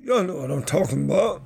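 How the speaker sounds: mock-exasperated comic catchphrase; Bernie Mac. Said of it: "In my Bernie Mac voice".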